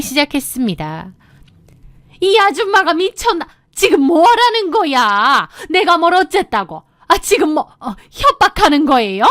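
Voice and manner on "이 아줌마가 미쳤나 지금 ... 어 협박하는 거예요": high-pitched panicky indignant mother-in-law; flustered, raised voice. A narrator's reading. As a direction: protest in alarm, pitch jumping up. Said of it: "당황하시면서 목소리를 높이시는". (당황해서 목소리 높이는 시어머니)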